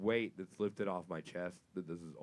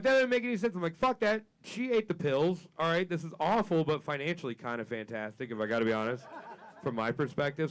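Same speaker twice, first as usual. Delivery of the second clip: high, loud, laughing rant; excited rant; 7 semitones higher, 6 dB louder than usual. Retold or imitated. To the crowd, ranting about the dog with laughter.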